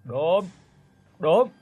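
a touch of annoyed threat; mild scolding shout. A narrator's reading. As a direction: scold the dog from inside the house. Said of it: "quát vọng ra".

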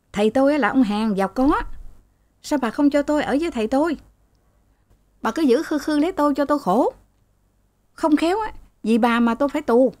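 high, fast, resentful son retorting to his mother; sulky angry son. A narrator's reading. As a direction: snap back at mom, rushed and high-pitched. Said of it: "Rồi dò thêm". (con trai cáu kỉnh, giọng cao và nhanh)

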